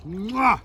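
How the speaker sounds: loud smacking kiss; kiss noise. Real, not acted. Angler blowing the fish a loud kiss goodbye.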